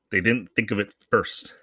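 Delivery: ordinary delivery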